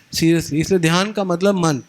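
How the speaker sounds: normal talking voice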